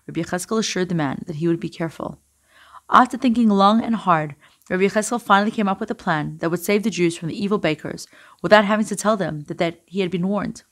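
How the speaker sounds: usual speaking voice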